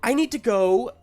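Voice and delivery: high, hurried, stumbling reassurance; high-pitched nervous woman character; flustered Sarah. Acted. Game master voices flustered Sarah.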